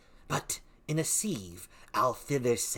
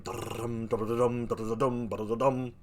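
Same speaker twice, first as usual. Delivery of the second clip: chanted drum-drum sound; rhythmic drum chant; imitated drumbeat. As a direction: beat it out like a drum.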